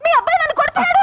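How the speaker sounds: high-pitched wail of complaint; shrieked tattling on a husband; crying out. Acted. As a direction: yell it so the in-laws hear. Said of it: "ఏంటి అరుస్తున్నావు".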